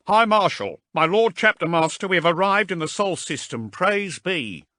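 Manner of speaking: loud, high ringing report; formal announcement. A narrator's reading. The shipmaster announcing arrival to the High Marshal, loud. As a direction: announce it to the bridge.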